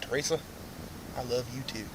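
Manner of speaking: soft, low, tender confession; retold tender reply. Randy answers Teresa after the quarrel, acted soft tenderness. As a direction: drop right down to a quiet, sincere murmur.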